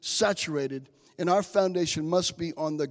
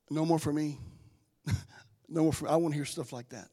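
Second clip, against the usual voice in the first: usual speaking voice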